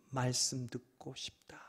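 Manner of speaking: breathy whispered longing. Acted. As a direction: whisper it like a private wish. Pastor voicing inner longing.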